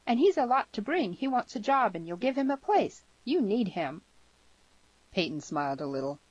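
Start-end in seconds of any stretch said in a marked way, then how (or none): 0.1-4.0 high, emphatic daughter's voice; pushy girl pleading a case; girl, insistent. A narrator's reading. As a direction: press Father firmly in a high voice.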